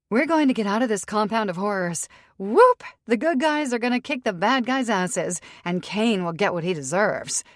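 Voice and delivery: triumphant cheer with a whoop; excited cheering. Acted. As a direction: whoop it up like the cavalry's coming. Lizzie cheering their coming rescue.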